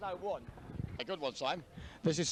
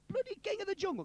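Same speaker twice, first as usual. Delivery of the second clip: high, indignant Yorkshire lion; 12 semitones higher than usual. Acted. Lion answers the mouse, Yorkshire accent. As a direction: put on a comic Yorkshire accent. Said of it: "'cause it's a Yorkshire lion".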